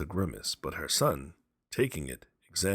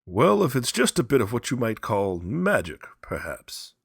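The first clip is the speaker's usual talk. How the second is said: offhanded; 7 semitones higher, 8 dB louder than usual. Acted. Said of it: "said the sergeant major offhandedly".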